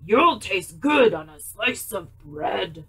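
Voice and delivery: loud, slow monster voice. Acted. Gruffalo tells the mouse it's his food. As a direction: growl it like a big hungry beast.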